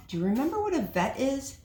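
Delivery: ordinary delivery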